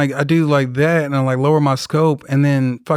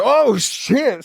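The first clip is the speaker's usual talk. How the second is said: startled curse; 9 semitones higher than usual. Retold or imitated. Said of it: "I was like".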